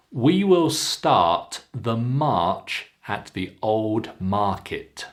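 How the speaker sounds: slow, long "start", "march", "market". Shown as a demonstration. Teacher models practice sentence, slow long ah in "march". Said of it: "keep the R sound nice and long".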